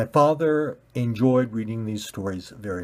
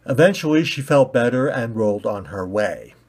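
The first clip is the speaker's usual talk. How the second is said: usual speaking voice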